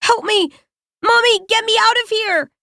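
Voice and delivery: put-on high, frightened little girl crying for help; a scared girl begging from inside a coffin; trapped girl pleading. A narrator's reading. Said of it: "I can make out faint words coming from within".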